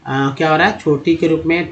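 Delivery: ordinary talk, nothing marked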